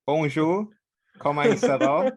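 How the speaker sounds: high, loud attempt at a French accent; mock French accent. Shown as a demonstration. Joker showing friends an "amazing" French accent, badly faked. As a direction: show off a hammed-up French accent. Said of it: "my amazing French accent".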